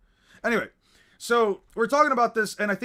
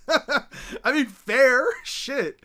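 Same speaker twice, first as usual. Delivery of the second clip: laughing; 7 semitones higher, 5 dB louder, much slower than usual. Real, not acted. Laughing at friend's remark.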